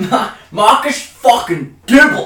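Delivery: loud, high, drawn-out shout of the name; excited shout. Real, not acted. Repeating his mate's name with a delighted shout. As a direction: yell the name back, delighted.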